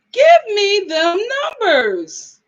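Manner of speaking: slow, stretched-out plea, high then falling; drawn-out demand. Real, not acted. Host begging a viewer for daycare prices, drawn-out demand. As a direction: drag out every word for comic effect.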